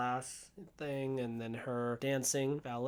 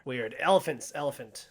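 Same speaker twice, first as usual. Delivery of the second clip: usual speaking voice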